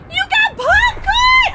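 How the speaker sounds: high, loud, drawn-out shriek; excited scream. Real, not acted. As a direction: shriek with glee. Game event surprise.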